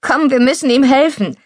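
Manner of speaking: rally someone to the rescue; urgent call. Acted. Urging help after brother falls.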